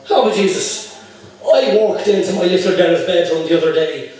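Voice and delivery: hammed-up Irish accent, lifting at the punchline. Irishman telling the barman about his daughter, Irish accent. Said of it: "The Irishman says".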